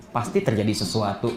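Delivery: low, soft suspicious thought; curiga dalam hati. Acted. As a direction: murmur it low as if thinking to yourself. Curiga dalam hati, lalu diulang. (gumaman curiga, pelan dan rendah)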